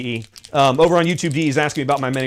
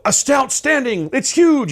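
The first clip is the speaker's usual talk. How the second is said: higher, louder hammed-up movie trailer announcer; mock fifties trailer voice; 11 semitones higher, 4 dB louder, slower than usual. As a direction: hype it like an old monster movie trailer. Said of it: "in the style of, like, a nineteen fifties Godzilla trailer".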